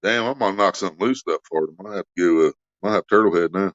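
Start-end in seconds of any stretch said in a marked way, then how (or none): none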